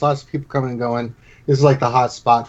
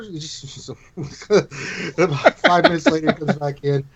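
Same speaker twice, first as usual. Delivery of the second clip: usual speaking voice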